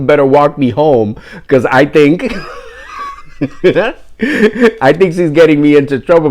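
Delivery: words broken up by laughter; laughing. Real, not acted. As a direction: try to get the line out while laughing. Laughing through his own retold reaction.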